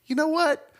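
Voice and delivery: a light mock Lucas having an epiphany; George Lucas second-guessing. Imagined Lucas rethinking the accents, lightly mocked. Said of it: "just be like".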